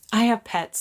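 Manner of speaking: normal talking voice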